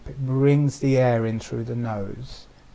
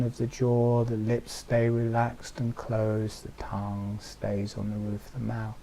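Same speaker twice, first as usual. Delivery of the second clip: guided meditation; 2 semitones lower than usual. Acted. Leads listeners into altered state.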